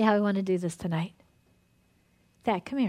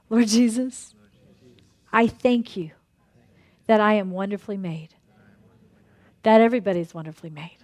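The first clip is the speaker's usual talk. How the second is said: earnest prayer; 2 semitones lower than usual. Acted. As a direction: pray it out for the whole room. Leads a group prayer.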